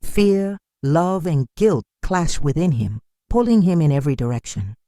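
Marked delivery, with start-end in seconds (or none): none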